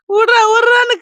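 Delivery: high, loud cry of let go; reliving the shout at the robbers; retold scream. Woman reenacting her scream at the chain snatchers, loud and high. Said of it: "கத்துறேம்மா நான்".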